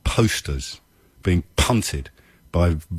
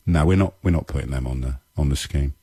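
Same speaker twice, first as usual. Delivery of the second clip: low, offhand refusal; callous dismissal; 5 semitones lower than usual. Acted. Host voices the Home Secretary refusing dead cleaners' families, callous. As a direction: imitate a minister waving it away. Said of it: "a Home Secretary can look at".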